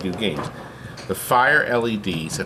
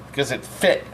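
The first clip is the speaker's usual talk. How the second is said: usual speaking voice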